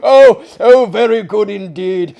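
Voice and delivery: loud, high laughing delight; laughing amusement. Acted. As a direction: shout it through laughter.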